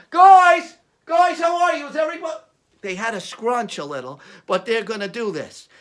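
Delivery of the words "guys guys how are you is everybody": loud call to a crowd. Acted. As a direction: shout a greeting to the choir. Calling out to the choir.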